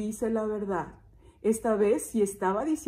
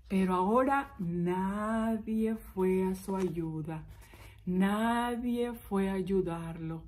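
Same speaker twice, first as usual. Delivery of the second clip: slow, lowered, hushed storytelling; somber narration; 3 semitones lower, 4 dB quieter, slower than usual. A narrator's reading. Storyteller telling that nobody came to help, slow and somber. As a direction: draw the words out gravely.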